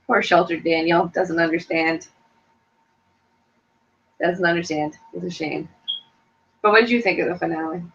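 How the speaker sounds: ordinary talk, nothing marked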